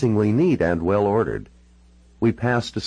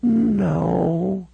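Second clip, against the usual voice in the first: put-on high, very slow elderly voice; frail old lady dwelling on each word; very old woman. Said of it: "She looked all of ninety-six years".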